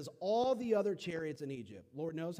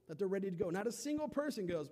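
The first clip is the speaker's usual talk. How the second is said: ordinary talk, nothing marked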